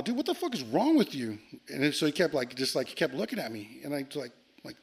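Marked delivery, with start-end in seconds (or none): 0.0-1.4 high-pitched retold angry challenge; acted indignant question; getting madder. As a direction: confront him, exasperated. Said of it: "I'm getting madder now".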